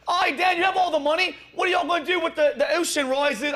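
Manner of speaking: put-on a deliberately terrible Aussie accent asking a question; bad Australian accent. Host mocking a woman in the crowd, bad Australian accent. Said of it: "that was the worst Australian English accent".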